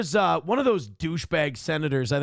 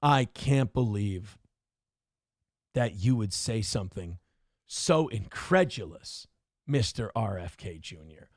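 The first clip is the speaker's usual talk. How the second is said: low, slow, pompous indignation; mock indignant senator; 8 semitones lower, 3 dB quieter, slower than usual. Mocking a senator offended by a comeback, low slow indignation. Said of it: "And they're like".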